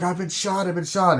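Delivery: high-pitched mock alarm; wounded panic. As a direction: cry out like a man just shot, retold. Acting out a wounded man.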